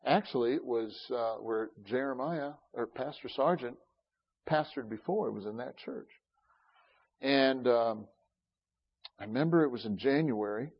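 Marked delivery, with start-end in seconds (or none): none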